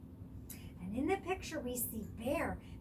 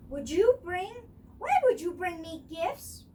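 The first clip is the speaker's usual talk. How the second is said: louder, wary rabbit voice; suspicious rabbit; 6 semitones higher, 9 dB louder than usual. A narrator's reading. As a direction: question them warily.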